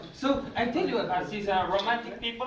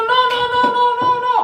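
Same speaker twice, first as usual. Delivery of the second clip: very high, louder frantic protest; shrill-pitched mock panic, much higher and louder; fussy customer squeal; 10 dB louder, much faster than usual. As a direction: squeal like a picky diner. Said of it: "And they go".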